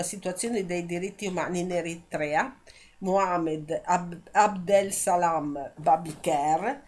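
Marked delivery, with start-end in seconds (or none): 3.0-4.3 slow careful name. Real, not acted. 4.3-6.8 slow careful name. Real, not acted. Name restarted slowly.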